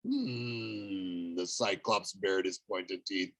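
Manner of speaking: low, slow hungry monster; hungry cyclops. A narrator's reading. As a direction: growl it like a giant licking his lips.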